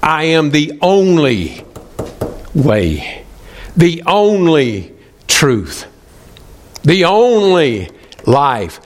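ONLY drawn out long and slow, with pauses; emphatic stress on only. Acted. Preacher quoting Jesus's vital words, emphatic stress on "only". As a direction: stretch "only" each time, then let the next word land. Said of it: "It's emphatic".

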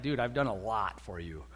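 low, weary talking-down; mildly condescending. Acted. Jesus to Peter who should catch on, mildly condescending. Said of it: "it sounds kind of condescending".

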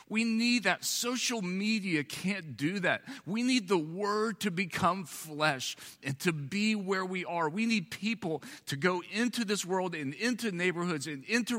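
high, driving sermon plea; fervent pulpit call to action; preaching at full force. Acted. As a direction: press the congregation, urgently. Preacher builds to appeal.

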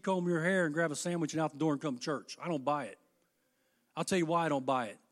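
ordinary talk

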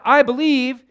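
desperate loud cry, high and forceful; a father's loud desperate cry for help; cried out. Acted. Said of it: "The father of the child cried out".

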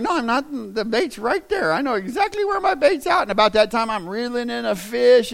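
high-pitched, playful self-defence; mock excited protest. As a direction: retell it as an excitable fisherman protesting innocence. His excited protest while fishing.

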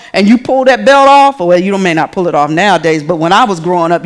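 ordinary talk

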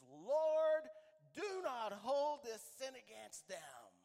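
loud, high, drawn-out plea to God; a dying man's loud final prayer. Acted. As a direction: cry it out at full voice, slowly. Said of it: "In a much louder voice".

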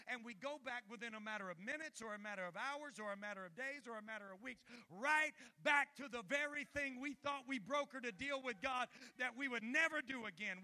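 loud, high shouted sermon; preaching at full force. Acted. Pastor shouting warning at the church, full force. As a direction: thunder it at the congregation.

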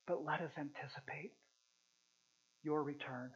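hushed closing prayer. Real, not acted. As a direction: pray quietly over the church.

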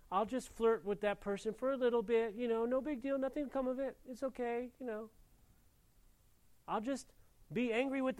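mock careless believer. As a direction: play the sinner brushing it off lightly. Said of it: "And we say".

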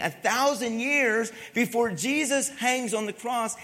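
loud, high, driving sermon; fired-up pulpit preaching; preaching at full force. Acted. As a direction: preach it out to the crowd.

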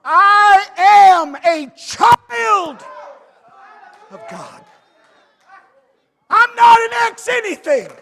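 full-force shouted preaching, slow. Real, not acted.

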